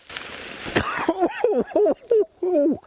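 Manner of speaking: long coughing from pepper fumes; coughing fit. Real, not acted. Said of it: "The jalapenos got me again".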